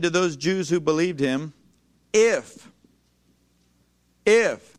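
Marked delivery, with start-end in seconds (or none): 2.1-4.8 If called out louder; emphatic if. Shown as a demonstration. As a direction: hit If hard for the crowd. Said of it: "everybody say if".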